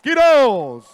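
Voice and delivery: long, loud drawn-out name; ring announcer name call. Acted. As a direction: stretch the fighter's name for the arena.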